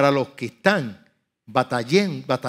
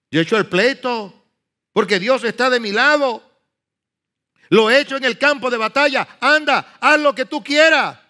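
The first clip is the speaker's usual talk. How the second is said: defiant; 7 semitones higher, 4 dB louder than usual. Retold or imitated. Nehemiah's defiant answer, voiced.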